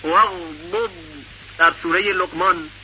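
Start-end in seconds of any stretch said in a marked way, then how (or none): 0.0-0.9 واغضب chanted much higher with a rise; high recited word. Shown as a demonstration. Teacher recites from Surah Luqman in high chant. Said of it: "یا در کلمهٔ".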